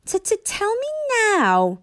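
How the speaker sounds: high, stuttering little dragon voice. A narrator's reading. Toothless demanding jokes from Hiccup, high stutter. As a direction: stammer it out like a small dragon. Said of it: "said Toothless".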